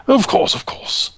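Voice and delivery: put-on a smiling old man agreeing; captain agreeing with a smile; lightly voiced old captain.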